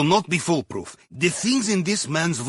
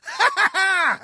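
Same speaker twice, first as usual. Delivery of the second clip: gleeful laugh; 13 semitones higher than usual. Acted. Gloating handler laughs.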